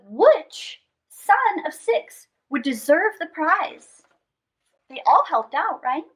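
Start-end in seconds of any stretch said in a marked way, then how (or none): none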